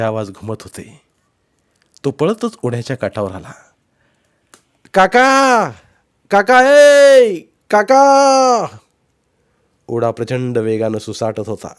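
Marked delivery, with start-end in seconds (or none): 4.9-8.8 long, high full-voiced shout; calling out. A narrator's reading. Boy hollering for his uncle across the stream, calling out. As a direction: holler with the last vowel drawn out. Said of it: "त्याच्या हाकेनं".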